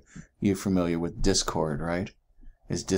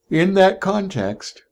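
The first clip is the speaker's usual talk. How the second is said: higher, louder and slow, stretched by a yawn; 12 semitones higher, 8 dB louder, slower than usual. Real, not acted. Teacher yawning mid-lecture to her class, yawning. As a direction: let a real yawn pull "In that context" out long.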